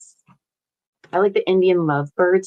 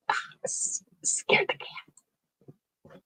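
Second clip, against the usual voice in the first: whisper; 10 dB quieter, much slower than usual. Real, not acted. Startled, whispering to self.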